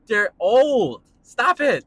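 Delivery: loud, high outburst of protest; riled up, push it out loud and high; heated. Real, not acted. Ranting about old leading men, heated. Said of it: "That really did get me heated up".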